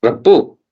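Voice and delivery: Rabbu ending on a short, light u; light form. Shown as a demonstration. Teacher repeats the light form for students, Rabbu with short u. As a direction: say Rabbu with no n after the u. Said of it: "use it in a light form".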